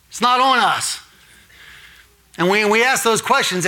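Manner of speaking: fervent pulpit build-up; preaching at full force. Acted.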